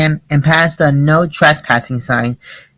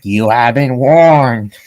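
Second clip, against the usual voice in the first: very slow, lower menacing warning; threatening voice; 5 semitones lower, much slower than usual. Acted. Doorbell voice threatening the gang, slow and low. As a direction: threaten them, dragging each word. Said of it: "the voice threatened".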